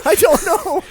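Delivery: high, loud blurt of confusion; flustered outburst. Real, not acted. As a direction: cry out that you have no idea. Flustered answer to co-host's laughing question.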